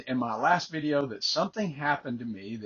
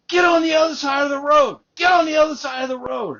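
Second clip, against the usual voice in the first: reenacted furious shout, much higher and louder; angry yell; 12 dB louder than usual. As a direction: bellow it like the jogger screaming at the driver. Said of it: "And then he yells at me".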